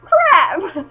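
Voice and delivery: startled yelp. Retold or imitated. Panicked reaction to forum followers.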